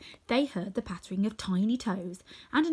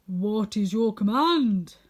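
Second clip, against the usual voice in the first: slow and louder, a bowing king's formal question; monkey king; 7 dB louder, slower than usual. Acted. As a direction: serve your mistress with solemn ceremony. Monkey king bows to Dorothy.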